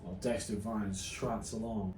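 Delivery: hushed mock nature-documentary narration, low and soft; hushed commentary. Host narrating the guest's search under his breath, hushed.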